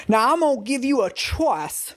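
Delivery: bad fake Texas accent. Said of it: "extremely fake Texas accent".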